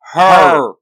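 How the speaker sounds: loud, emphatic one-word shout; explosive answer at full volume. A narrator's reading. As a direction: shout it out as a whole team. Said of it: "all the other Rangers shout".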